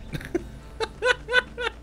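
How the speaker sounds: high, helpless giggle; self-mocking laugh. Real, not acted. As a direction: crack up at your own confusion. Laughs at own confusion.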